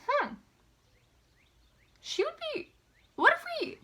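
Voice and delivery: high-pitched, halting pondering; musing idea. Retold or imitated. Casting partners puzzling after an audition, high tentative musing. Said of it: "and we went".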